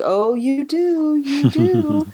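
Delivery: high, drawn-out sing-song cooing; patronizing insistence. Retold or imitated. Scientist insisting to a patient he needs care, patronizing.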